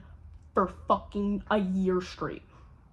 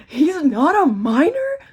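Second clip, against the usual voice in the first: shocked question; 8 semitones higher, 13 dB louder, slower than usual. Retold or imitated. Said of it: "And she goes".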